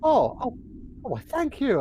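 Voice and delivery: high, loud gushing gratitude; overjoyed adventurer thanking the GM; excited thanks. Acted. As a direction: gush over the gift in character. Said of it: "I'll, uh, like".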